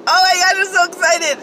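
high, loud gush of joy; real excitement. Real, not acted. Vlogger gushing to viewers about her haul, loud excited cry. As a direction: let the thrill spill over.

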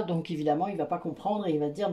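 usual speaking voice

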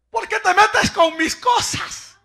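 put-on slow, high, shouted protest of a sulky kid; angry child shout. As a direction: yell it like a teen whose phone was taken. Child protesting phone taken. (grito de adolescente enojado, lento y agudo)

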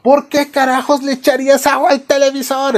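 high, incredulous yell, acted; mock furious outburst. Father learns of water on TV, mock furious. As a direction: play an appalled dad yelling.

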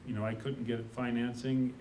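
ordinary talk, nothing marked

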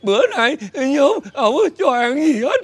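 slow, hammed-up sobbing; crying hard. Father complains he was not fed, crying. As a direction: wail it out between sobs. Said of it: "sao anh khóc dữ vậy".

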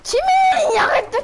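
yelling. Real, not acted. Yelling at Timmy.

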